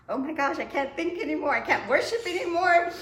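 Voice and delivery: rising panic that can't be ignored; mock-frantic inner monologue. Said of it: "So I was like".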